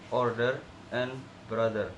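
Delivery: "order and brother" with a hard D for th; wrong, th as D. Shown as a demonstration. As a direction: say "brother" as "brodder". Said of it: "replaced with a D".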